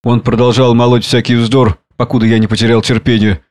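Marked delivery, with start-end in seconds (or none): none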